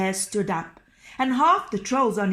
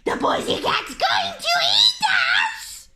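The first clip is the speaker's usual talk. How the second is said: troll voices; 6 dB louder than usual. A narrator's reading. Terrified trolls flee the bear.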